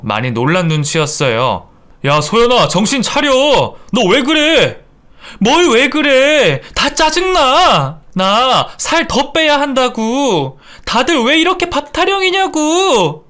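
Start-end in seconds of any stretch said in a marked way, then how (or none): 2.1-4.8 high, urgent acted scolding; alarmed shout. Shocked son calling his raging wife to order, shouting. 5.4-13.3 high, drawn-out angry wail; raging tantrum. Acted. Raging daughter-in-law screaming at the family over food, frenzied. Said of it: "미쳐 날뛰는 며느리를".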